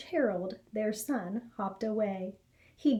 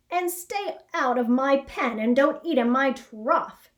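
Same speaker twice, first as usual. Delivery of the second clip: put-on louder grumpy old pig; a pig telling off the rabbit; grimy pig; 3 semitones higher, 7 dB louder than usual. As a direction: shoo him from the trough. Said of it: "said a grimy old pig".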